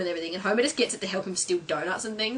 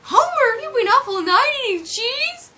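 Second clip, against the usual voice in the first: high, loud cartoon wife scolding; much higher, louder mock nagging; Marge; 8 dB louder than usual. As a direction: scold your husband in a high voice. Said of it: "Marge comes in".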